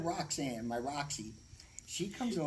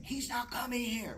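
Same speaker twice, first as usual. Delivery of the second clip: mild mock scream; 9 semitones higher than usual. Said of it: "she starts screaming".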